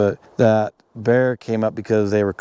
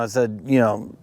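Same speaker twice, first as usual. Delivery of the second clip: ordinary talk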